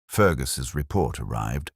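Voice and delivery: ordinary delivery, nothing marked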